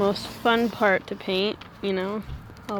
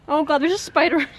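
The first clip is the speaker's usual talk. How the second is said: startled shriek at a spider; high, loud spider panic; screaming at a spider; 6 semitones higher, 6 dB louder than usual. Real, not acted. Spotting a spider on her.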